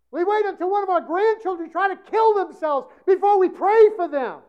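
loud, rising preacher's cry; preaching at full force. Acted. Preacher pleading with the congregation, loud and rising.

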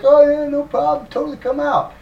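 high, sing-out mock-agreeable voice; fake breezy agreement. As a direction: pitch it way up, falsely accommodating. Police feign easy agreement with terrorists.

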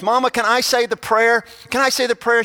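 a hint of an eager child asking; lightly voiced little boy. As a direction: lift the voice a little like a keen child.

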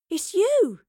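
high cry of recognition; excited cry. Acted. Girl suddenly recognising a familiar face, crying out. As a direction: cry it out, pitch leaping up. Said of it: "she cried".

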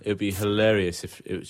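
ordinary talk

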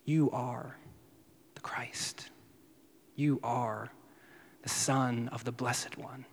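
soft, falling, half-whispered statement; whispered-down declaration with a falling end; hushed statement. Shown as a demonstration. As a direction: hand it over softly, as a fact. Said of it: "getting the high priest to say the statement".

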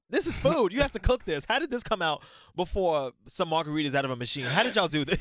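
high, loud retold complaint; mock indignation. Diner retelling protest over food arriving early, mock indignant.

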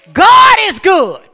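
God is good, drawn out and loud; slow shouted praise. Real, not acted. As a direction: proclaim it slowly at full voice.

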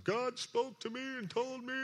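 mock-pious high wail; over-religious proclamation. Preacher mocking religious show to the congregation, high, over-pious. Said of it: "didn't get all religious on 'em".

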